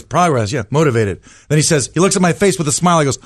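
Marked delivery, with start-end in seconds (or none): 0.0-1.2 low-pitched, unimpressed echo; imitating acting teacher. Acting teacher repeating the student's answers, mild impression. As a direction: hand the words back lower, as the teacher.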